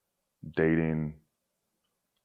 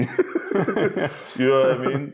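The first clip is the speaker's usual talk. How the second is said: laughing through the words, a little louder; 8 semitones higher, 4 dB louder, faster than usual. Real, not acted. Guest laughing at his own screw-ups while telling the host. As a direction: chuckle out the question at yourself.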